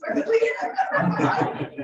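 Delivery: a bright burst of laughter; amused laugh. Real, not acted. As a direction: laugh at yourself. Laughing at her own voice.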